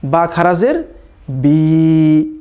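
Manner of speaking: বি drawn out slowly into a long ee; বা খাড়া জের বি with a lengthened বি. Shown as a demonstration. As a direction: stretch the বি out, slow and long. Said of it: "তখন পড়তে হবে".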